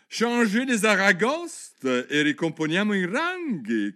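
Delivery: loud, high, slowed command; calling dance steps. A narrator's reading. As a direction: announce the figure like a dance master. (comando alto e scandito)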